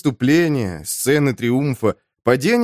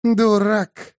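higher snarled insult; 8 semitones higher than usual. Acted. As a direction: spit the insult at him. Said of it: "ощерилась Сторкул".

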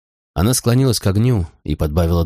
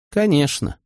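cool, higher-pitched one-word reply; understated chill in a higher voice; calm and cold; 7 semitones higher, slower than usual. A narrator's reading. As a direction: answer with composed coldness. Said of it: "спокойствие и холодность в её голосе".

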